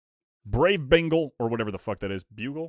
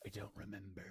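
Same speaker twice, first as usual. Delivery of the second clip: hushed, barely voiced whisper; 6 semitones lower, 23 dB quieter than usual. Streamer musing to himself over a card set, whispered. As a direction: whisper it under your breath. Muses aloud, then repeats it drawn out.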